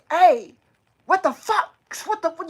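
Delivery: sudden high, loud retold outburst; finally snapping. Retelling finally snapping at a bully, angry outburst. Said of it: "and I finally snapped".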